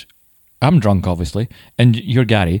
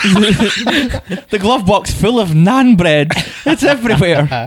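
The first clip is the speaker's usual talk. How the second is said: laughing; 9 semitones higher, much slower than usual. Real, not acted. Joking about naan bread.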